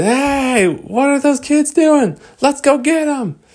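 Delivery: high, loud shouted guard voice; angry guards. Acted. InGen guards spotting the teasing kids, high loud shout. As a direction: bark at the kids to catch them.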